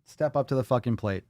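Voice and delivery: ordinary delivery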